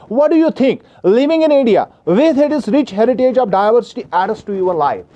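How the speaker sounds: slow, careful reading aloud to a class; slow textbook reading. Acted. Teacher reading the question to students, slow and careful. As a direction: read each word out slowly for the students.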